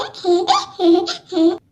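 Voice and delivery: short low giggle of mockery; mocking giggle. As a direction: laugh at her friend's failure. Mocking laugh at friend.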